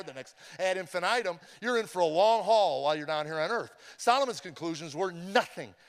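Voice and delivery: loud, high, driving preacher voice; preaching at full force. Real, not acted. Testimony builds into full preaching.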